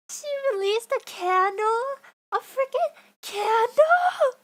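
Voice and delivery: high, loud shriek of disbelief building to a wail; voice-straining incredulous yell; screaming. Real, not acted. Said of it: "I said I would save my voice".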